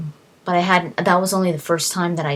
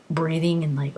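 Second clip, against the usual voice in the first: ordinary talk, nothing marked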